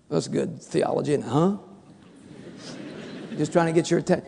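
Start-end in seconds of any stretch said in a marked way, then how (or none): none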